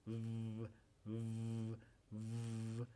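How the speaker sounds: lip-to-teeth v, voiced; voiced v sound. Shown as a demonstration. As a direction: hold a long buzzing v. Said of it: "el labio inferior y los dientes".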